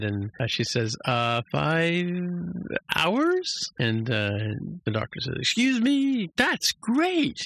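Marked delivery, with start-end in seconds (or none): 1.0-3.7 squeaky question. Retold or imitated. Tendi unsure estimate. 5.4-7.5 high squeaky mock surprise, much higher. The doctor reacts to Tendi's estimate with a high squeaky surprise.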